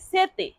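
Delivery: সেতে with the t made as Bengali ত; soft dental t. Shown as a demonstration. Teacher showing students how Italian t sounds, dental t. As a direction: say সেতে as "SE-te", tongue on the teeth for t. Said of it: "টি এর উচ্চারণ ত এর মতো হয়".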